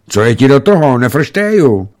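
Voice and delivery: put-on high, loud child's retort; defiant child. A narrator's reading. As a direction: answer back like a suspicious kid. Suspicious child snaps back from hiding.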